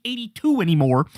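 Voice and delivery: usual speaking voice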